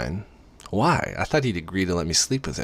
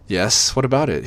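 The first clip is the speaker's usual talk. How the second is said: mildly stern, louder and higher on the challenge; 6 semitones higher, 5 dB louder than usual. A narrator's reading. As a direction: challenge them with a touch of sternness. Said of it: "he asks sternly".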